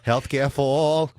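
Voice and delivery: hammed-up Bernie chant; Bernie Sanders impression. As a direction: channel an old senator at a rally. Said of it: "That's so good".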